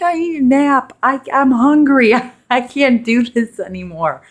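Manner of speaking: high, loud whiny plea; exhausted whine. Retold or imitated. Worn-out mother in labor begging for a nap, high whine. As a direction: whine like you can't go on.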